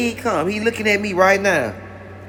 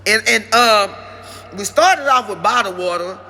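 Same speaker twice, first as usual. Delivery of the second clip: ordinary talk, nothing marked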